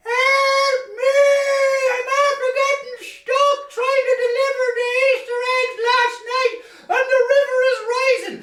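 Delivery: very high, tearful squeaky cry for help; high, panicky rabbit voice pleading from the river; frightened Easter Bunny. Acted. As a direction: squeal like a tiny animal stuck on a rock. Stranded bunny cries for help.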